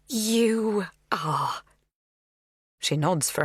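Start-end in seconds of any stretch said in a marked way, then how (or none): 0.1-1.6 coaxing whisper; cajoling. A narrator's reading. Magda coaxing the girl like a child. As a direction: coax her like a child. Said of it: "her tone cajoling".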